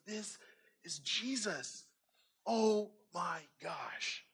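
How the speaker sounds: slow, hushed amazement; acted soft, drawn-out awe; awestruck realization. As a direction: let it dawn on you, very softly. Mary realizes it is Jesus.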